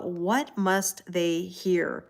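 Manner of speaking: very slow, word-by-word pondering; wondering aloud. Retold or imitated. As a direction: stretch each word out as you wonder. Acts out her own wondering thought.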